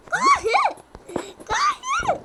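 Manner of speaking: frantic high scream of run; grito desesperado. Real, not acted. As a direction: shriek it in panic. Fugindo do monstro, gritando. (grito de pânico)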